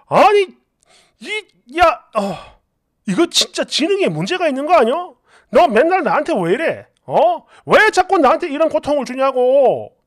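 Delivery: sputtering start, then pleading complaint; exasperated outburst. Acted. Husband at wit's end with wife, exasperated outburst.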